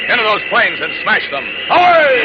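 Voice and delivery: rising, loud charge cry; heroic battle shout. Acted. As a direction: hurl yourself into the fight. Hero charges the planes.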